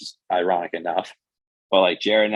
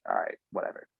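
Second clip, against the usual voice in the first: whispered, much softer aside; a voiceless shrug to himself; shrugging mutter; 12 semitones lower, 10 dB quieter than usual. Retold or imitated. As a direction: breathe it out, unbothered. Dad doesn't answer phone.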